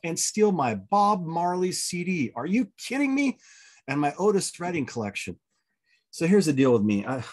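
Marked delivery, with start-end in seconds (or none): 0.0-5.4 can you believe this; retelling the theft in mock outrage; exasperated disbelief. Car broken into, music stolen.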